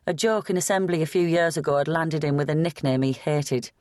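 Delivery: ordinary delivery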